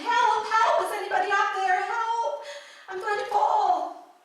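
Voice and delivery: high, loud, acted panic call; yelling for help. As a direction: call out loudly from the cliff, hammed up. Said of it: "he begins yelling".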